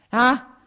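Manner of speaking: loud, high cry of despair; anguished cry. Acted. Husband tormented by jealousy cries out to wife, anguished. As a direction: cry out, hands in hair. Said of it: "gridò lui".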